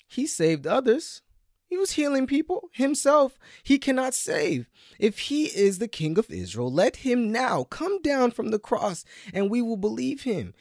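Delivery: high, loud jeering taunt; scornful crowd taunting a dying man; mocking priests. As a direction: sneer at him from below the cross. Preacher voices priests mocking Jesus.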